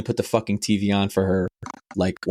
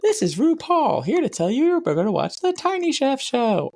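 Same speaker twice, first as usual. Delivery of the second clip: high, singsong show announcer. Retold or imitated. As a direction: introduce a kids' show with flamboyant flourish. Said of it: "Fucking [name] is doing it".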